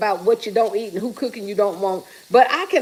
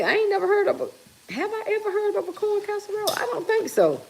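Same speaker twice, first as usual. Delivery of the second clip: high, soft puzzled question; incredulous; 9 semitones higher, 6 dB quieter than usual. Real, not acted. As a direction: wonder aloud in disbelief. Never heard of corn casserole.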